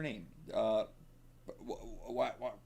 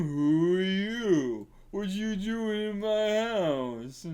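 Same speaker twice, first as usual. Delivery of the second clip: slow, higher dim troll; slow, higher troll challenging an intruder; 7 semitones higher, much slower than usual. Acted. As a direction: play a slow-witted troll confronting an intruder. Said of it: "a troll".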